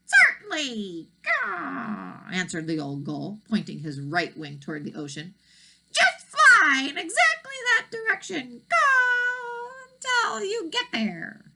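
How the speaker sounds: put-on high, loud, cawing old seagull; croaking old gull. A narrator's reading. Gull speaks.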